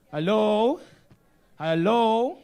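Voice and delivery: loud, high, rising call to the audience. Real, not acted. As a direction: check if the room is awake. Calling out to an unresponsive audience.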